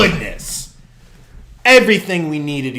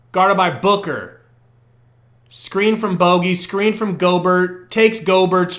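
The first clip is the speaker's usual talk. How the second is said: ordinary delivery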